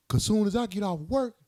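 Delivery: a hint of the girlfriend, matter of fact; lightly voiced girlfriend; mock girlfriend.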